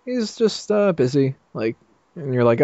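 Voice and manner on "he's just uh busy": high, hesitant playing-dumb cover story; coy high fake innocence; evasive Lois. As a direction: pretend not to know, high and coy. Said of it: "And she's just like".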